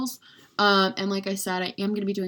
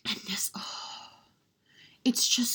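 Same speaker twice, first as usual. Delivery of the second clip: slow hushed whisper of admiration; awed whisper; 3 semitones higher, 5 dB quieter than usual. Real, not acted. Showing off the pricey highlighter in an awed whisper. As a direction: breathe it out like it's precious.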